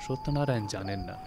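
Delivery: ordinary delivery, nothing marked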